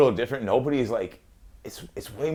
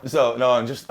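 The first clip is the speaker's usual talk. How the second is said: ordinary talk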